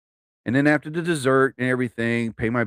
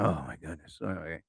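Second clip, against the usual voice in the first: low, very soft resigned mumble; retold grudging aside, barely voiced; reluctant aside; 11 semitones lower, 15 dB quieter than usual. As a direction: mutter it under your breath, imitating reluctant agreement. Reluctant aside when coworker asks to talk.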